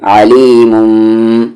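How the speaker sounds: आलीमुम with the final meem hummed nasally; with ghunna. Shown as a demonstration. Teacher showing the nasal hum on meem, slow. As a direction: let the meem of आलीमुम ring through the nose. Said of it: "गुन्ने के साथ".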